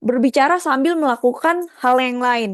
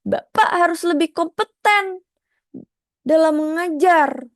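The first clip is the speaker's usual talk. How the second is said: raised intonation; 7 semitones higher than usual. Shown as a demonstration. Teacher contrasting a pushy intonation with a calm one. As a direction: push it up to sound demanding. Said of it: "Jadi beda".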